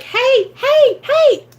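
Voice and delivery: very high, loud laughing hey; squealing greeter. Pretend. Door greeter squealing hello to newcomers. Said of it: "I'm like".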